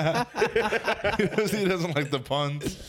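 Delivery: laughing. Real, not acted. Laughing hard after pun.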